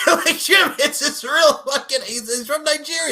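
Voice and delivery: very high, loud laughing protest; exasperated disbelief. Real, not acted. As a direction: plead the obvious through incredulous laughter. Exasperated retort to the played clip.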